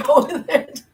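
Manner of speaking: words spilling through a laugh. Real, not acted. Shrugging off YouTube drama, laughing. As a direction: laugh while saying it.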